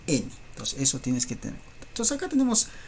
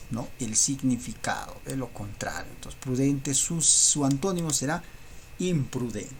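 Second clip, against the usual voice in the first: ordinary talk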